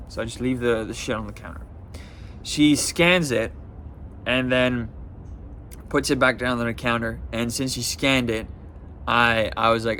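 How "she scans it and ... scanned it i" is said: ordinary talk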